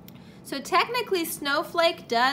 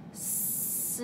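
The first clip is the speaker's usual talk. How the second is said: breathy whisper, sound by sound; whispered demo of stretching snowflake; whispered slowly; 13 dB quieter than usual. Shown as a demonstration. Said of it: "Say it slowly".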